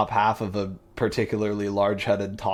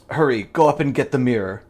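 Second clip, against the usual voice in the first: urgent order; 4 semitones higher, 7 dB louder, faster than usual. Acted. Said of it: "he says".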